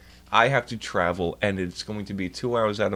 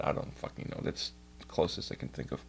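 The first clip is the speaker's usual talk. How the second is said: whispered mutter; 8 semitones lower, 12 dB quieter than usual. Real, not acted. Muttering while searching.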